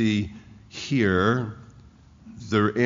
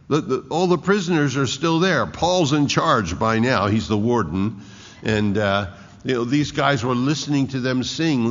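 normal talking voice

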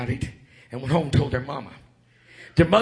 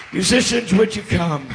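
usual speaking voice

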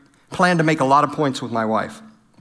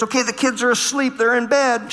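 mock holler; 7 semitones higher than usual. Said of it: "I holler downstairs".

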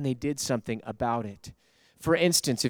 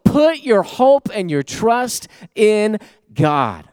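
high, loud, slow shouted exhortation; preaching at full force; 10 semitones higher, 7 dB louder, slower than usual. Acted. Preacher urging the church, full-force shout. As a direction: cry it out to the soul, drawn out.

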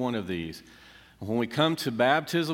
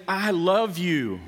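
"I love you" slow and drawn out, high and loud; slow, ringing "I love you" from God; God saying I love you; 6 semitones higher, 4 dB louder, much slower than usual. Acted. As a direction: stretch "love" out, fervent and tender. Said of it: "it's God saying".

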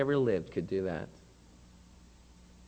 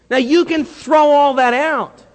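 loud, high preaching cry; booming preacher; 9 semitones higher, 11 dB louder than usual. Real, not acted. Preacher to congregation, booming loud. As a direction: throw it out to the whole room.

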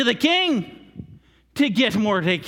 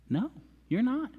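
ordinary talk

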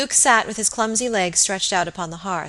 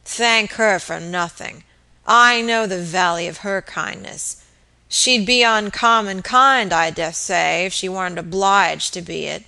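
put-on slow, loud drunken sneer; scornful drunk, dragging the words; 4 dB louder, slower than usual. Drunk husband scorning the lady.

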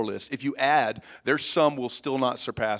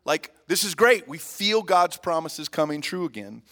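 higher-pitched mock-giddy excitement; excited, higher voice, like retelling their glee; 4 semitones higher than usual. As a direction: act out a giddy crowd celebrating. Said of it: "They're all giddy. They're like".

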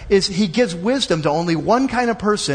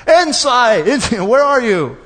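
loud searching calls, pitched up; calling out; 4 semitones higher, 5 dB louder, slower than usual. Retold or imitated. Preacher acting a seeker calling for insight in the streets, loud calls. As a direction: shout it down the street like you've lost something. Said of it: "So you're calling out".

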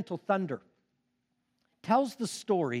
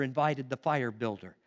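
ordinary talk

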